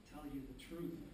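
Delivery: usual speaking voice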